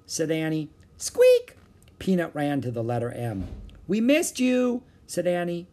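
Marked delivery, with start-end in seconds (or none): 1.0-1.6 very high, loud mouse squeak. A narrator's reading. Peanut greeting Jack and Annie, mouse squeak. 3.9-4.8 high, eager pet-greeting voice; affectionate greeting. A narrator's reading. Annie telling the mouse she missed it, affectionate greeting.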